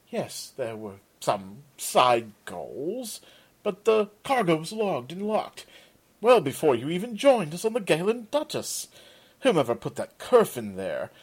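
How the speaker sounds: stiff, offended self-defence; haughty merchant protesting innocence; a touch of pompous outrage; indignant. Acted.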